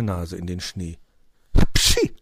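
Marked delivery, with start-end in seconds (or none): 1.7-2.2 loud acted cat sneeze. A narrator's reading. Cat sniffing the snow, sudden sneeze. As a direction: sneeze explosively, high and sudden. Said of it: "machte er".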